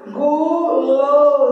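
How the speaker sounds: "guloseimas" in four long, slow syllables; deliberate sounding-out of "guloseimas". Shown as a demonstration. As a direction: stretch "guloseimas" into gu, lo, zei, mas. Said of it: "Quatro vezes você abre a boca".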